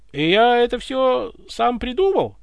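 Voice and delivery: high, loud, drawn-out wonder; admiring amazement. A narrator's reading. Ivan learns the order was his own, delighted amazement. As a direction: marvel at yourself, slowly and loudly. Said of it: "восхитился Иван".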